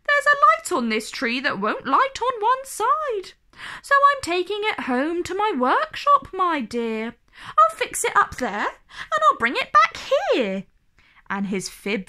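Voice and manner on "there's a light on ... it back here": much higher, louder, syrupy fake Santa voice; fake Santa lie. A narrator's reading. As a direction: fib to the child in a high jolly Santa voice. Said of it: "the fake Santa Claus lied".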